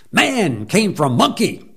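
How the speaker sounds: mock shout. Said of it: "and I shouted".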